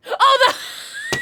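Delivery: very high, loud laughing shriek. Real, not acted. Streamer learning a viewer's real name, shrieking laugh. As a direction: burst into shrill laughter.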